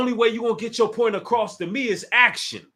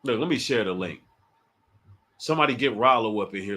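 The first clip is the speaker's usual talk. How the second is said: ordinary talk, nothing marked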